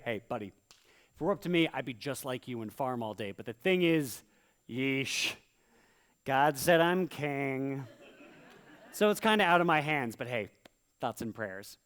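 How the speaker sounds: king fobbing off starving peasants; smarmy king. Acted. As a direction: shrug it off with smarmy pity. King placating starving people.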